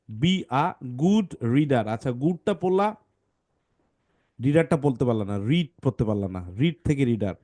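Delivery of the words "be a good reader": be a good reader read slowly word by word; slow model reading. Shown as a demonstration. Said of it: "এটা হবে want".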